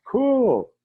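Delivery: high, loud, stretched "cool"; wowed one-word outburst, falling at the end; impressed. Retold or imitated. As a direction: exclaim it in mimicked amazement. Repeats medicine man's one-word reaction.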